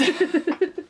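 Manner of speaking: short high laugh; real amused laugh. Real, not acted. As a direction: chuckle at your own joke. Laughs at own joke.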